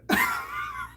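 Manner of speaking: very high, breathless laugh; squeaky burst of real laughter; real wheezing laugh. Real, not acted. Teller recalls laughing so hard, high wheezing laugh.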